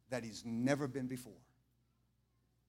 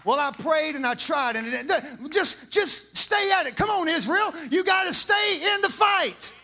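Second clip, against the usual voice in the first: loud, much higher, slow shout to the crowd; hollering; 12 semitones higher, 12 dB louder, slower than usual. Real, not acted. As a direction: holler it out to the back row. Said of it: "I feel like I'm hollering".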